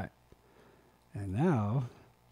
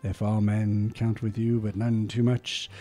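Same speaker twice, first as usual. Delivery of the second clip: rousing recital that swells louder at the peaks; dramatic poem reading; 3 semitones higher, 12 dB louder than usual. Acted. Reading Rudyard Kipling's If aloud, dramatic poem recital. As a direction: read Kipling like a stirring speech.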